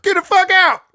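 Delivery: high, loud yelled PA order; mock shout. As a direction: bark it over the loudspeaker. Adding to PA joke.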